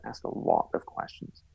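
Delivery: slow, soft, breathy whisper. Real, not acted. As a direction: hush it like a secret tip. Repeats advice, then hushed repeat.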